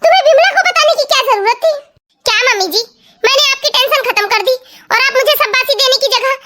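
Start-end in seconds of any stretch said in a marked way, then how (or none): 0.0-1.7 higher, half-laughing scolding mother-in-law; exasperated mother-in-law. Acted. (बहू को डाँटती, परेशान सास की ऊँची आवाज़)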